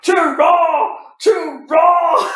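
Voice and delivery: roaring wrestling commentator call; commentator shout. Retold or imitated. Friend imitating the commentator's shout at the return. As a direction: bellow it like a ringside announcer.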